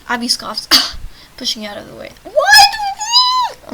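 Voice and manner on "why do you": very high, loud, drawn-out whine; exaggerated squeal. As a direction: squeal it out in mock protest. Sudden squeal at game choice.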